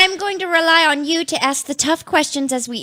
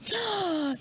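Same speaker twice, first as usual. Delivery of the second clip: starstruck gasp; 3 semitones higher, 13 dB quieter than usual. Real, not acted.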